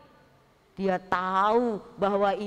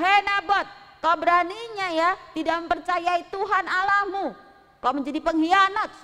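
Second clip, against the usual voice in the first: loud, higher accusing shout; angry crowd; 7 semitones higher, 5 dB louder than usual. Acted. Townsfolk accusing Nabot, a loud angry shout. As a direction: blast him as a traitor.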